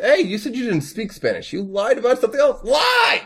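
high, fast mock-outraged yell; mock outrage. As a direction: accuse with hammy outrage. Mock accusation.

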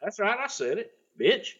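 lightly voiced smug heel; smug catchphrase. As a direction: toss it off with a smug sneer.

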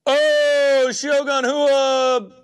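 high, slow, drawn-out amazed cry; excited. Real, not acted. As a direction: stretch it out in awe. Big card pulled from pack.

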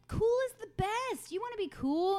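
hammed-up cheerleader pitch for cool; a parent overselling cool to a little daughter, hammed-up and high; mock enthusiasm.

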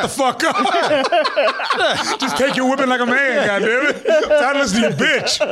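mock voice. Mocking slave voice.

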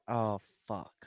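low, soft, deflated groan of a curse; quiet dismay. Retold or imitated. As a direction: sink as you realize it's lost. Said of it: "And I was like".

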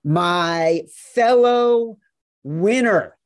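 slow, stretched and loud; drawn-out emphasis. Acted. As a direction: stretch out my fellow winner. Drawn-out intro build.

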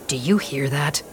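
hushed, low whisper. Acted. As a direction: whisper it so nobody overhears. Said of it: "he whispers".

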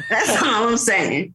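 slow, drawn-out shouted repeat; slow mock shout. Mom repeating her point to the toddler, slow mock shout. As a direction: hammer the punchline home slowly.

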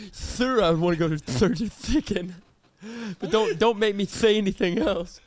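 "church's chicken" with ch lisped into th; mock lisp. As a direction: play the friend lisping through "church's chicken". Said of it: "my least favorite is people that say".

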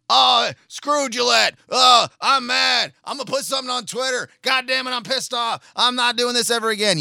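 high, hammed-up angry shouting; shouting mock rage over a razor commercial; mock outrage. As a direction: play the fake-furious customer ranting. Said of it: "they're gonna say today".

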